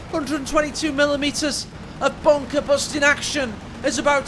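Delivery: loud, animated play-by-play building in pitch; high-energy live call, punching the big moments; excited game caster. Real, not acted. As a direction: call the climax like a wrestling commentator.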